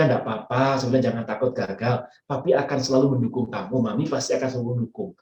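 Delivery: retold tender comfort to a son; gentle reassurance. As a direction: reassure him quietly that he's supported. Father comforting son after test.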